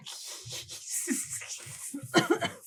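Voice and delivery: unvoiced high creepy giggle; a sinister hissing giggle; eerie high laugh.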